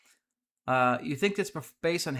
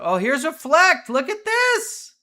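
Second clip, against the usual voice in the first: loud, very high-pitched amazed outburst; excited; 14 semitones higher, 10 dB louder, slower than usual. Real, not acted. Streamer spotting Reflect on the map, excited. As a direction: shout it out, voice leaping way up. Spots a fast-growing new player.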